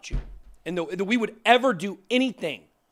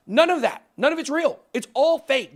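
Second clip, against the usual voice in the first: louder and higher, spitting out each denial; fed up, shutting down the rumors; angry outburst; 6 semitones higher, 4 dB louder than usual. Real, not acted. Host angrily denying scams to viewers, raised voice. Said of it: "I get so pissed at this stuff".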